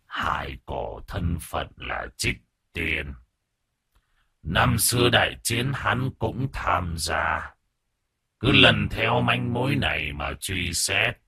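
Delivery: put-on slow whispering old man. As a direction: breathe it out slowly like an ancient elder. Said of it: "giọng nói lại là một ông lão già nua".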